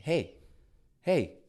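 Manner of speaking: put-on high, loud kid's call, building to a shout; a small child tugging for attention; child calling dad.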